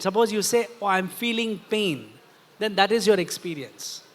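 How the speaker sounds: normal talking voice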